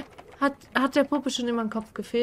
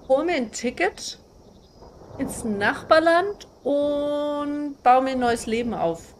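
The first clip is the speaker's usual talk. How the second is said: ordinary talk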